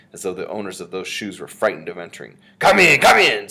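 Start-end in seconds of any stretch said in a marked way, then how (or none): 2.6-3.5 very loud, high, impatient order; shouted, irritated summons; snapping. A narrator's reading. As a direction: snap it like an imperious witch. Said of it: "snapped the Grand High Witch".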